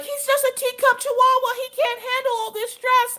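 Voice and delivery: very high-pitched mock hysterics; hysterical lady. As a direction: mimic a frantic dog owner way up high. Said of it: "The lady is hysterical".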